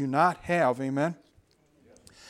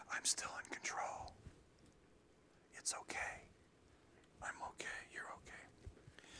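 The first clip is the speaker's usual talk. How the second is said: soft unvoiced whisper, slowing to the end; hushed reassuring whisper; 20 dB quieter than usual. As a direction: breathe it like a gentle secret.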